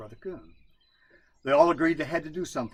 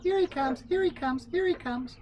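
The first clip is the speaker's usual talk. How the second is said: much higher, tiny peeping voices; little frogs peeping; 3 dB louder than usual. Acted. Little frogs along the river warning each other, high peeps. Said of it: "would peep".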